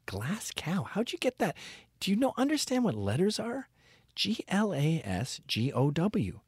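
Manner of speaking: high, incredulous, spelling slowly; mock exasperation. Mocking someone who mispronounces Glasgow, high. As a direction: scold someone who can't read letters. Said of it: "They call it Glasgow".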